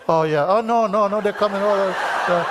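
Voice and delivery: hammed-up alarm, they're coming; mock alarm. Student overwhelmed by thoughts.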